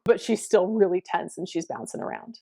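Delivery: ordinary delivery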